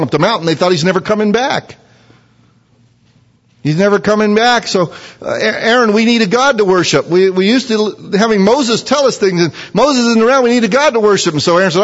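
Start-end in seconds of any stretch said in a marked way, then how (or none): 3.6-11.3 high, anxious pleading; voicing the people. The people pleading with Aaron, high and anxious. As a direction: beg Aaron for a god.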